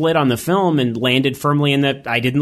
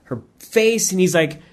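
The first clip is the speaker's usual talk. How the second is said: ordinary delivery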